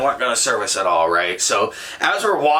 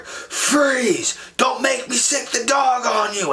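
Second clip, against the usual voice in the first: high-pitched, shouted cop warning; imitated police shout; 12 semitones higher, slower than usual. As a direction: call out a threat to a fleeing suspect, acted. Cop shouting orders at fleeing kid.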